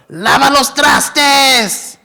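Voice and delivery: high, slow, drawn-out yelled command; grandma's shout. Retold or imitated. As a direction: holler the chore like a grandma across a field. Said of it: "And then she would go".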